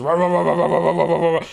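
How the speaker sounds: loud, high, rapid-fire barks; imitated irate barking. Retelling the dog as they get closer, loud irate mock barking. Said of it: "the dog is getting more and more irate".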